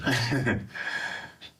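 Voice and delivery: brief rueful laugh, high; short wry laugh. Real, not acted. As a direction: laugh it off before answering. Laugh before answering about help.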